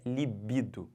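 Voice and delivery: "libido" as liBIdo; stress on BI. Shown as a demonstration. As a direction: place the stress clearly on BI in "libido". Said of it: "a pronúncia correta é". ("libido" com a tônica no BI)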